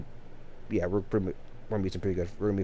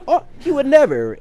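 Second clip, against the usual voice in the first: much higher, louder mock of an offended mother; offended foster mother; 14 semitones higher, 6 dB louder than usual. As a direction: toss it off high and indignant, like a mother denying it. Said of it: "she was offended, was like".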